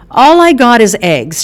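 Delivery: high, loud call from the next room; yelled from the kitchen. Acted. Mother calling from the kitchen, loud yell. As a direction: shout it through the wall. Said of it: "she yelled".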